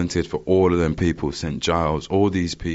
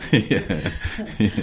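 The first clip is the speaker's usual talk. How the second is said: real chuckle into a short "yeah"; amused laugh running into the word; 6 semitones higher than usual. Real, not acted. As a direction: laugh it off at the story's end. Laughs after ending her story.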